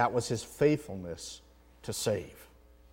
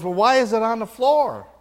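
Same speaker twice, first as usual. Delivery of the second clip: higher, louder mock bewildered husband; puzzled husband; 9 semitones higher, 9 dB louder than usual. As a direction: ham up the husband's confusion. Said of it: "And he goes".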